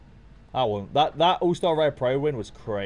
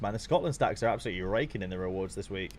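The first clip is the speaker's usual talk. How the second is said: usual speaking voice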